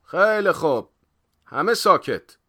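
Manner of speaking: high, very loud call for silence; loud schoolmaster taking control; stern order. A narrator's reading. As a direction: bring the room to order sternly. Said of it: "با قیافهٔ عبوسی گفت".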